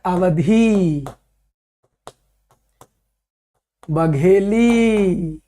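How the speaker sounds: each dialect name drawn out slowly with long pauses; slow dictation. Acted. Teacher slowly dictating Hindi dialect groups to students. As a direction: spell it out slowly so students can write it down.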